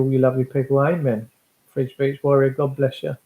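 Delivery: ordinary delivery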